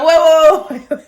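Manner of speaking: high, loud, comic whoa; hammed-up panic, about to laugh; mock alarm. Daughter backpedaling as mom walks away, mock alarm.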